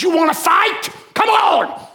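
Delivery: high, shouted mock dare; defiant challenge. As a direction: imitate a man picking a fight. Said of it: "Shook his fist at God".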